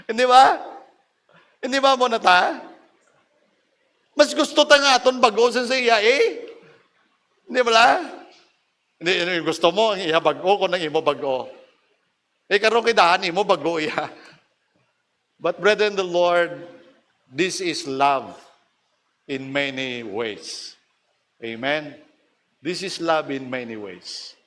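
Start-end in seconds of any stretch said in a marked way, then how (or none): 0.1-7.9 high, laughing challenge; teasing. Real, not acted. Pastor teasing the brethren about selfishness, high and laughing. 17.3-24.4 slow, hushed preacher line; solemn. Acted. Pastor to brethren on love, slow and hushed.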